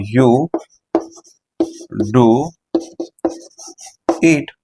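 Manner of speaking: "do" isolated between long pauses; stress on do. Shown as a demonstration. Teacher giving the emphatic English form slowly. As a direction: pause then hit "do". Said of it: "ये बनेगा".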